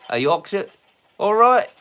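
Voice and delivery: put-on Yorkshire accent. Shown as a demonstration. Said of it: "something more Yorkshire".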